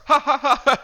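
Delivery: high loud hammed-up cackle; mock cackle. Imitating Elmer laughing at Brian, a high cackle. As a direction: cackle like the creature mocking him. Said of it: "he starts laughing at him".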